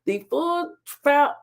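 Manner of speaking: ordinary delivery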